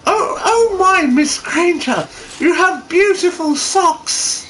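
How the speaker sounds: high, slow elf voice in awe; high, slow awestruck elf voice; shocked awe. Acted. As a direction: gasp over the socks like an elf. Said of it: "in a mix of shock and awe".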